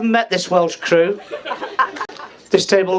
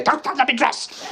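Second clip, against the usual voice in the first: higher, softer, half-laughing daughter's voice; a hint of a bossy daughter; mock daughter's telling-off; 7 semitones higher, 5 dB quieter than usual. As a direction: lightly mimic her scolding dad. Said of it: "my last rollicking off list".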